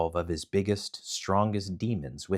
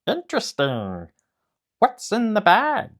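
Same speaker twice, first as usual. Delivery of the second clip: very high, loud monkey chatter; 14 semitones higher, 7 dB louder than usual. Acted. Monkey drops from the tree to ask Momotaro, high chatter.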